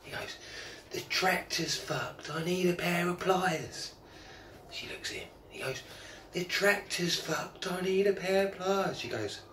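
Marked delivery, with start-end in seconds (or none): 0.9-3.9 slow, higher call to someone far off; calling up the hill. Retold or imitated. Farmer calling up to wife. 6.3-9.0 slow, higher call pitched to carry; calling up the hill. Retold or imitated. Farmer calling again to wife.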